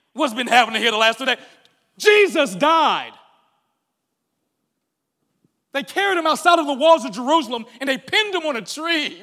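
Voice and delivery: loud, high, exasperated disbelief; incredulous disciples. Retold or imitated. Disciples on the road scolding the stranger, loud incredulous rant. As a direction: bellow it like you can't believe he hasn't heard.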